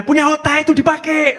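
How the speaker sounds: high-pitched mock scolding yell; retold yelling. Mother yelling at her child, mock scolding. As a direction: yell it like a parent telling off a child. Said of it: "sama teriak-teriak".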